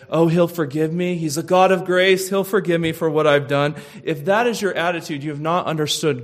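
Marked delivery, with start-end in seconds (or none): 0.1-3.7 higher, louder, breezy he'll forgive me; mock a careless believer shrugging off sin; flippant excuse. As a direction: lightly toss off he'll as if nothing matters. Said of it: "Do you say".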